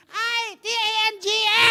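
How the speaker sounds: high, loud hammed-up yell building to the last word; mock shouted insult. Wife to apostle about husband, a hammed-up high yell.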